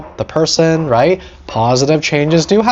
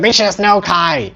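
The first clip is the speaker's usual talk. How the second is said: entitled whine; 5 semitones higher than usual. Retold or imitated. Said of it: "Some of us here are like".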